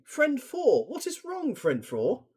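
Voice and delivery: lightly voiced worried friend; friend two voice. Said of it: "Friend two".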